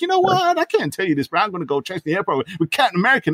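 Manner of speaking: high hammed-up Scotty; mock Scotty. Retelling Scotty's excuse, high and hammed up. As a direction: ham it up as Scotty. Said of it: "Scotty's like".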